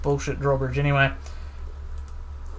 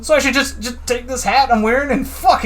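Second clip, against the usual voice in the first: more indignation; 7 semitones higher than usual. Acted. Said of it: "Maybe add more indignation?".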